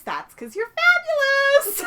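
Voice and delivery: very high, loud, slow flattery; gushing compliment. Retold or imitated. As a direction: lay the praise on thick. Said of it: "And she says".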